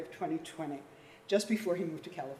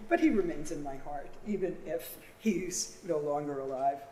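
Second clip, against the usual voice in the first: ordinary delivery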